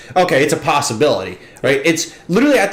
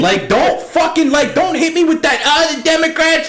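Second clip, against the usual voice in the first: exasperated rant; 13 semitones higher than usual. Real, not acted. Mocking news framing.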